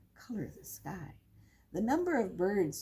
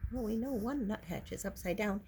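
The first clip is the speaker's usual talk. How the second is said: plain reading voice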